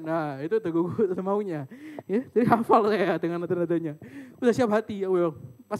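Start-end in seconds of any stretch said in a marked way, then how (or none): none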